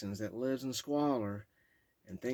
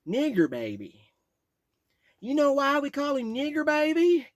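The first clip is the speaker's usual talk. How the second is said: put-on heavy Texas accent; 15 dB louder than usual. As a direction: boast it in a thick Texas accent. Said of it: "his heavy ha-, Texas accent".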